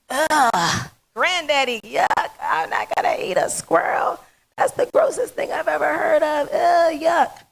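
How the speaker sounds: put-on high, rising whiny kid voice full of disgust; grossed-out little girl. Little girl recoiling at granddad's squirrel talk, high disgusted squeal. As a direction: squeal it like a grossed-out four-year-old.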